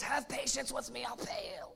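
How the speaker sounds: strangled, breathless plea fading to almost nothing; choked voice. Acted. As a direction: beg while someone squeezes your throat. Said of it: "that's how you talk when you're getting choked".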